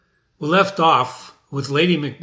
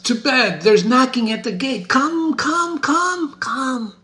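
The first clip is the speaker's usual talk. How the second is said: sleepwalking Lady Macbeth; 11 semitones higher than usual. Acted. Reader voicing Lady Macbeth walking in her sleep, high and haunted. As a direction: plead into the dark as if asleep.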